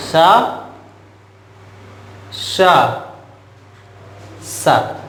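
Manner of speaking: three sibilants sounded singly, शा, षा, सा; sibilant contrast demo. Shown as a demonstration. Teacher showing students how three sibilants differ, sibilant contrast demo. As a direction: isolate each hissing consonant, शा, षा, सा, with pauses. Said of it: "उच्चारण में यही अंतर है".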